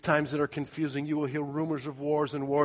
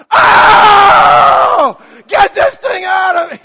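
high, very loud drawn-out yell; hammed-up labor wail; mock labor scream; 23 dB louder, much slower than usual. Imitating screaming in hard labor.

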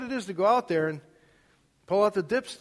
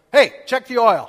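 higher and loud, a father's call down the hall; imitating dad yelling; 6 semitones higher, 15 dB louder than usual. Dad reminding his son before he leaves, imitated yell. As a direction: bark the reminder like a dad at the door. Father's parting words retold.

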